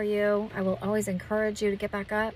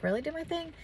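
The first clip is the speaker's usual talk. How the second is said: higher, softly incredulous question; retold surprise, pitched up; surprised question; 7 semitones higher, 4 dB quieter than usual. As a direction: check it with a rising, mildly disbelieving lilt. Retells own surprised question back to host.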